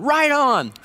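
high, bright "right on" from God; a hint of God boasting proudly; God cheering you on. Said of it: "You ought to hear God going".

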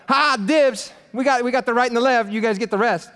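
high, taunting gloat. Retold or imitated. James and John gloating over the others, high and taunting. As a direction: rub it in their faces.

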